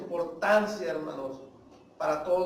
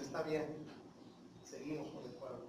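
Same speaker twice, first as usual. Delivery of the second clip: much softer, low concession; God agreeing to stay with the people; quiet relenting; 5 semitones lower, 13 dB quieter than usual. Acted. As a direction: give in gently and low.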